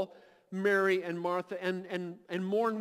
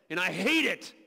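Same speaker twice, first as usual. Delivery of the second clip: lower, pressed, bitter declaration; firm hate; 4 semitones lower than usual. Acted. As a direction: spit out "hate" with low force. Voicing Jesus hating death.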